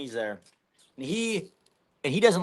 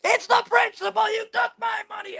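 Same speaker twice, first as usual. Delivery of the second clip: yelling. Retold or imitated. Crackhead exploding on the other side of the strip club, very high shriek. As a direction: scream it at the top of the register like a man losing it. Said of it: "He's yelling this".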